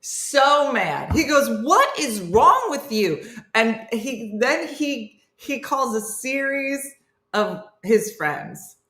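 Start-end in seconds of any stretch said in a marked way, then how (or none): 1.6-3.2 high, slow exasperated retort; mock mad. Guy answering a friend's good night call, mock mad. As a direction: imitate an angry friend, stretching the words. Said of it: "the guy gets so mad".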